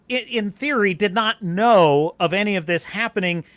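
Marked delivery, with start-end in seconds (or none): none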